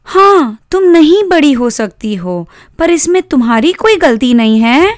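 put-on high-pitched girl comforting her doll; high, earnest girlish consoling; consoling little sister.

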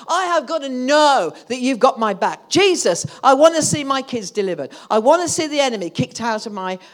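loud, high fervent preaching; preaching at full force. Acted. Preacher crying out prayer to Jesus at full force. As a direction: pour it out with fire.

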